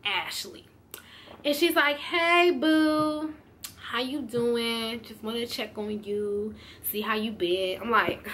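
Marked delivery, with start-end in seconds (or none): 2.0-7.8 softer, slow, syrupy sweet friend; sweet-talking friend. Retold or imitated. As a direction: coo it like nothing happened. Ashley sweetly calling after fight.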